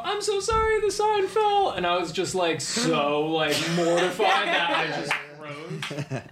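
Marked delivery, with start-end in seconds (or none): 0.0-1.7 much higher sweet apologetic voice; apologetic housekeeper. Retold or imitated. Guy imitating the housekeeper apologizing, high and sweet. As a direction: squeak out the apology like a flustered maid. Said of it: "I just hear her go".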